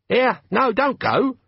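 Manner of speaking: high put-on female voice; falsetto woman. Sergeant in disguise pleading, high falsetto. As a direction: squeak it out like a man playing a woman.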